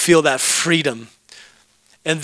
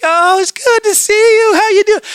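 over-the-top greeting; 6 dB louder than usual. Retold or imitated. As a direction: imitate a gushing stranger coming in for a hug. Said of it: "a greeting ramped way too high".

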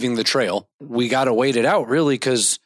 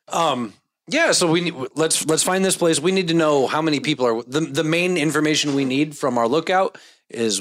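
ordinary talk

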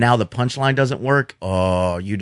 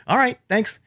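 curt thanks; 10 semitones higher than usual. Retold or imitated. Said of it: "I go".

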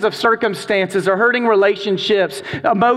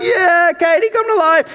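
much higher and louder, a group cheer; cheering shout; 10 semitones higher, 3 dB louder than usual. Retold or imitated. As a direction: yell it like eager teens egging her on. Kids cheering Katie on.

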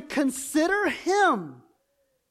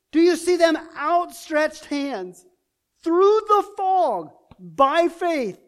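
rising, louder preacher's climax, drawn out; preaching at full force; 3 semitones higher, 4 dB louder than usual. Acted. Preacher urging the congregation to see Jesus, fervent and loud. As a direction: preach it to the crowd, building fervor.

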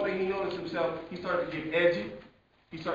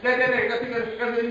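put-on excited child's rush, high and loud; talking real fast; 5 semitones higher, 7 dB louder, faster than usual. Daughter with important news for Dad, talking real fast. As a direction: tumble the words out urgently. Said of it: "she's talking real fast".

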